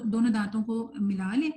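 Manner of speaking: ordinary delivery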